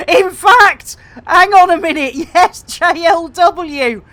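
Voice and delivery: loud, high, laughter-filled voice; laughing excitedly. Real, not acted. Streamer spots something in the acronym, laughing excitedly. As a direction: blurt it out through real laughter.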